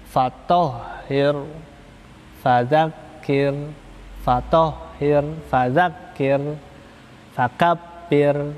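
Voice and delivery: slow, each final r kept thin; "fatohir" and "fadzakir" slowly with a thin r at the end. Shown as a demonstration. Teacher modelling thin final r words slowly. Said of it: "Misalkan contoh".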